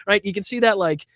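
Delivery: ordinary talk, nothing marked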